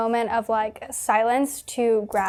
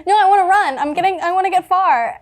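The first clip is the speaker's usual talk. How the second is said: panicked and laughing; 6 semitones higher than usual. Real, not acted. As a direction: laugh through the panic, desperate to run. Panicked refusal while laughing.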